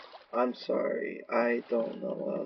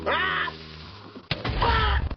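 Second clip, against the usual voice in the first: startled yelps. Real, not acted. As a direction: yelp at the sudden scare. Sudden startled screams.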